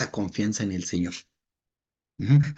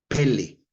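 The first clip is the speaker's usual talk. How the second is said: Pele with the first E stressed; 3 dB louder than usual. Shown as a demonstration. Teacher reading out a Hebrew word, Pele stressed on PE. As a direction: hit the first syllable of Pele, PE-le. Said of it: "con acento en la primera E".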